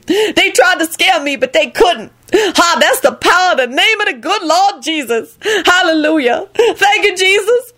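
high, jubilant churchgoing woman; triumphant Lucretia voice. A narrator's reading. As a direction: crow it up high in praise.

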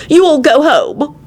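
high, slow order; stubborn. A narrator's reading. Elizabeth sending the others away, stubborn. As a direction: dismiss them firmly, high and slow. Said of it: "she added stubbornly".

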